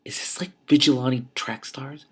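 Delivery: slow, voiceless conspiratorial whisper; awed whisper aside. Real, not acted. Reader whispering a theory about the story, in a slow whisper. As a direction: lean in and breathe the guess.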